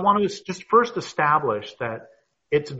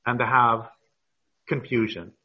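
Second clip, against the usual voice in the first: ordinary talk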